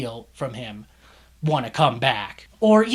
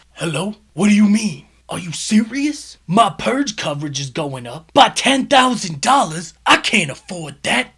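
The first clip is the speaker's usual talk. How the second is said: loud, high, frantic voice on the phone; louder, higher panicked phone voice; panicked deli owner; 5 semitones higher, 8 dB louder than usual. Retold or imitated. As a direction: play the shop owner freaking out on a call. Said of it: "the phone call plays out like this".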